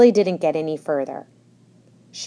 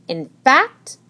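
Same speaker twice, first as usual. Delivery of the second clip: high, loud, stretched exclamation; urgent announcement; 12 semitones higher, 6 dB louder, slower than usual. A narrator's reading. Missus Higgins announces guests' arrival, urgently. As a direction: build to a flustered cry.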